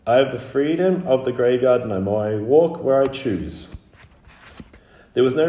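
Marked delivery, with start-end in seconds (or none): 0.1-3.7 high, loud formal declaration; recited proclamation. Acted. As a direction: proclaim it like a learned formula. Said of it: "as he had been taught".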